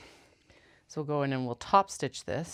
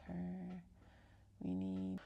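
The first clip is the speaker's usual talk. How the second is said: usual speaking voice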